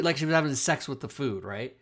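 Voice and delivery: usual speaking voice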